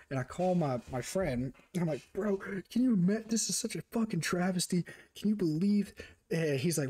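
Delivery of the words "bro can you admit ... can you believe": high-pitched, incredulous venting; hammed-up indignant rant; mock outrage. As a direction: overplay the injustice to a friend. Retold outraged phone call to friend.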